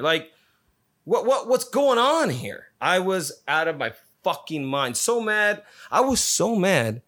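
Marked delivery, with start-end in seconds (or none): 1.0-2.6 exasperated outburst. Real, not acted. Ranting about shuttles sitting idle, exasperated and much higher. 5.0-7.0 pitched up, emphatic fury; heated rant. Real, not acted. Telling listeners about missing the flight, heated and higher.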